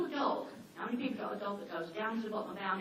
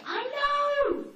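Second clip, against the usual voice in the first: much higher, loud "I know!"; fed-up cry; exasperated shout; 7 dB louder than usual. Retold or imitated. Exasperated reply to neighbour.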